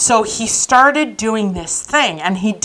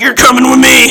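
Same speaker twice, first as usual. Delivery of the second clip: louder, mock-tough command; mock arresting order; 3 semitones higher, 7 dB louder than usual. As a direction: imitate a cop hauling someone off. Acting out cop cousin's arrest.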